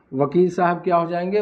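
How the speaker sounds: ordinary talk, nothing marked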